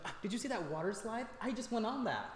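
put-on chirpy child telling a story; excited little girl.

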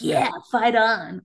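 slow drawn-out school cheer; lightly mocking fight song chant. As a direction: cheer it like a proud fan. People cheering school slogan.

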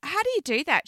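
ordinary delivery

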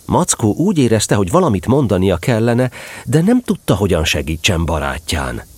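plain reading voice

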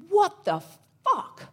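high-pitched, incredulous mock outburst; imitating shocked disbelief. Huge guy who had to dodge, high and shocked. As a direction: act floored, voice jumping up with a break mid-phrase. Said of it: "he was so shocked".

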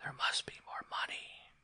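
very soft, voiceless whisper; secret whisper. A narrator's reading. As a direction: whisper it like a secret all over the house. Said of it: "he heard the secret whisper".

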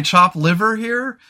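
ordinary delivery

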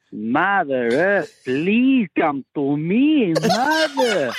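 very slow, high, rising chant-like wail; hammed-up pleading, each word stretched long and climbing; imitated loud wailing. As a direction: howl it out like a tripping man calling to the heavens. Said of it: "He was that loud".

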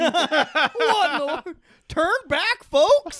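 high, loud prospector, laughing; old prospector. Pretend. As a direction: holler like a gold-rush geezer. Joining the prospector bit.

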